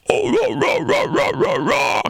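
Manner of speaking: high rasping metal screams; fry scream. Shown as a demonstration. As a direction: rip out short screamed rahs. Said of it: "fry screaming".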